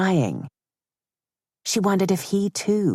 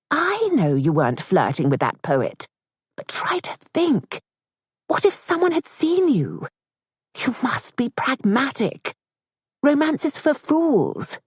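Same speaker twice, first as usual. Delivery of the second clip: a much higher, pressing mother's voice; insistent mother; 6 semitones higher than usual. Acted. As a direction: lecture her daughter with cool strategy. Mother lectures daughter.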